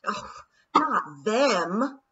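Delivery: a hint of an exasperated queen; dismayed Queen. A narrator's reading. The Queen dismayed as pixies arrive, exasperated. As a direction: throw up your hands in annoyance. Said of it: "the Queen said, throwing up her hands".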